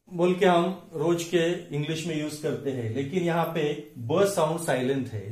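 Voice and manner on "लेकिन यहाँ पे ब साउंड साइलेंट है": ordinary delivery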